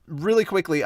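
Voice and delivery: usual speaking voice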